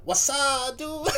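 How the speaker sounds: mock dude greeting. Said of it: "Almost sounds like a".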